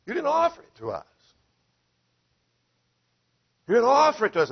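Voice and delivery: high, urgent protest. Retold or imitated. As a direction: cry out like an objecting crowd.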